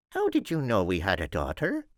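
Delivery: high mother voice, puzzled; high-voiced mother questioning the Count; wondering mother. A narrator's reading. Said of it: "Buttercup's mother wondered".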